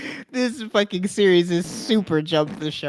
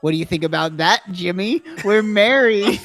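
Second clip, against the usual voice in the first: put-on English accent laughing villain taunt; gloating laugh; 5 semitones higher, 5 dB louder than usual. Villain taunting Jimmy about his marriage, laughing. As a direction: cackle it at him, gloating.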